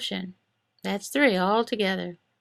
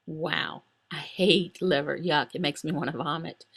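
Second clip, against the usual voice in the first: voice of emotion; 7 semitones lower, 3 dB quieter than usual. Acted. His emotions recoiling from liver, low and disgusted. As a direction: lower and softer, disgusted. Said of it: "My emotion?".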